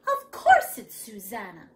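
loud, laughing dismissal; laughing mother. Acted. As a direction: laugh off the worry.

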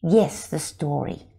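plain reading voice

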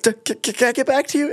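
high, stuttering mock nerves; imitating his own tongue-tied panic; nervous stammer. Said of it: "And I was like".

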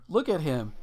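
ordinary talk, nothing marked

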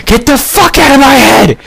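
high-pitched mock-frantic yell; acted panicked shout. Man answering the voices in his head, acted high yell. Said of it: "And I'd be like".

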